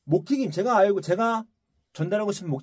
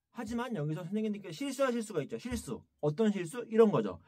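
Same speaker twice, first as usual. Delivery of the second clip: ordinary talk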